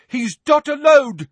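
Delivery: high, loud shout from above. A narrator's reading. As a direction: yell it down from above. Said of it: "shouted a voice from above".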